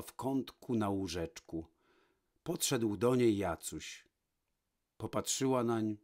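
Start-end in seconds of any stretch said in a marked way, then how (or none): none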